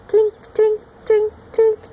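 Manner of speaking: high, loud ringing tinks; bright bell-like frog tinks. Shown as a demonstration. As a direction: chime it like a little bell.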